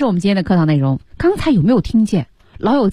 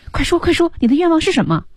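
eager pleading; 10 semitones higher, faster than usual. Acted. Retelling lion's eager begging.

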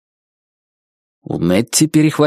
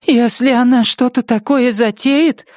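higher, thin, resolute; Nettie's vow; 11 semitones higher than usual. Acted. Nettie hugging the lampshade, resolute vow. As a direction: vow it to yourself.

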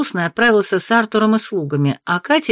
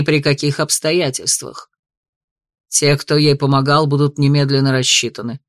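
low, measured, stern lord; stern duke; 5 semitones lower than usual. Acted. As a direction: lay down the law, low. Duke lectures children.

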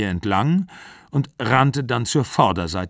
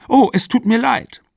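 high, loud flustered apology; startled, high-pitched exclamation; startled cry; 13 semitones higher, 6 dB louder than usual. A narrator's reading. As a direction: blurt it out, caught off guard. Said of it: "schrie sie".

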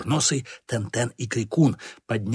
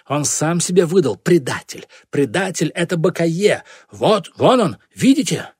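loud, higher, mounting accusation; excited accusation; 6 semitones higher, 6 dB louder than usual. A narrator's reading. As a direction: point out the traitor to all, louder and louder. Accuser exposing the traitor. (торжествующее обвинение)

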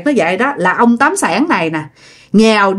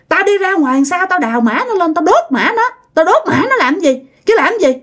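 high-pitched heated threat; acted quarrelling man; defiant threat; 8 semitones higher than usual. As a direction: insist hotly on doing it.

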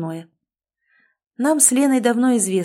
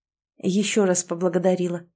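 plain reading voice

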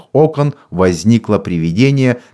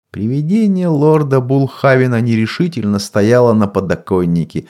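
usual speaking voice

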